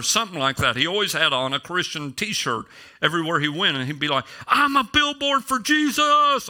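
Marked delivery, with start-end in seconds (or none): 4.5-6.5 high, slow, drawn-out mock yell; imitated yell. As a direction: holler it like an overzealous believer's slogan. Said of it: "And he would yell".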